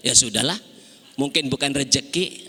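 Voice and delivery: low, resigned and easy; a hint of a calm mother shrugging; calm acceptance. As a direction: accept it gently, no fuss. Mother accepting lost motorbike.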